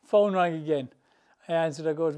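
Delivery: ordinary talk, nothing marked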